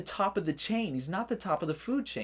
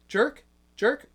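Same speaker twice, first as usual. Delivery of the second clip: high, louder, rising question; testing the word; 7 semitones higher, 5 dB louder than usual. Real, not acted. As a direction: try the word out loud, checking it gets through. Testing whether the word gets censored.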